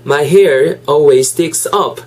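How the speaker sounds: unhurried English, word by word; slow model sentence. Shown as a demonstration. Teacher giving learners a second careful repeat, slowly. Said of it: "천천히 두 번만 더 해볼까요?".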